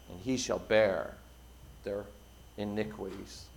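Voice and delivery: low, solemn preacher's reading; hushed, weighty close to a Bible passage; scripture reading. Acted. Reads Isaiah to the congregation.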